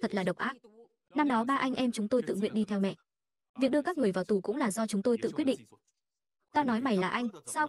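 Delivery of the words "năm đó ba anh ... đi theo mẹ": ordinary delivery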